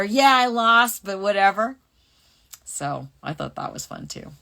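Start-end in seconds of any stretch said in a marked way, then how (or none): 0.0-1.8 sulky Juri Han. Acted. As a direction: brush off the loss, loud and high. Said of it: "Like she's just mad at the player".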